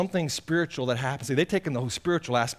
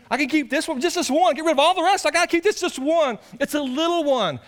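high, loud whiny begging; 13 semitones higher, 5 dB louder than usual. Acted. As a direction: plead like a child who won't let go. Sinner begging to keep sin.

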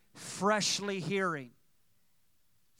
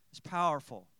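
normal talking voice

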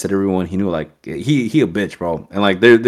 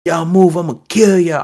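higher-pitched mock threatening command; retold cop threat; 10 semitones higher, 4 dB louder than usual. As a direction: imitate a cop talking tough. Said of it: "he's talking some hot shit".

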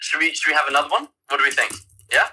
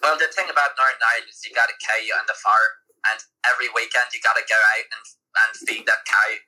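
quick Northern Irish attempt; amateur Northern Irish impression; Northern Irish accent. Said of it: "Northern Irish".

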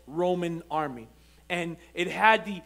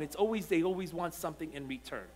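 ordinary delivery

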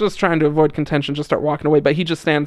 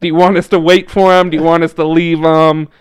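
louder, exasperated question to the angry man; missionary calling out to the angry guy, loud and exasperated; raised voice; 2 semitones higher, 10 dB louder than usual. Retold or imitated. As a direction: push it out loud, half pleading.

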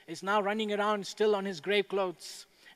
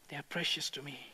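hushed repeat; 5 semitones lower, 9 dB quieter, faster than usual. Real, not acted. Tender repeat of Jesus' words.

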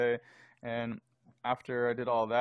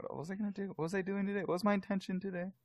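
confused; 8 semitones higher, 6 dB quieter, faster than usual. Retold or imitated. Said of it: "and then I forget".

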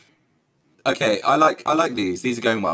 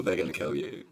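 whisper; 6 semitones lower, 7 dB quieter than usual. Real, not acted. Warning about the bleep.

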